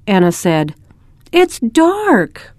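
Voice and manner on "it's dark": put-on a child's startled cry, much higher; little girl crying out at the dark house; dismayed cry. A narrator's reading. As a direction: squeak it out in dismay, high. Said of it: "Rose cried".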